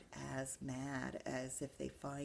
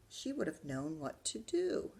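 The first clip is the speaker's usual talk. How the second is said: plain reading voice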